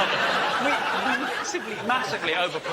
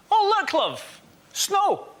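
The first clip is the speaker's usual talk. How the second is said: high, casual remark to a partner; note the snow lightly, high-pitched, as an unfazed Scot; 11 semitones higher, slower than usual. Acted. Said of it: "Scottish people do this".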